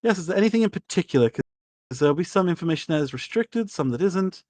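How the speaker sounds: a theatrical aristocrat overjoyed to see a guest; gushing lady. Acted. Lady Torrell answers.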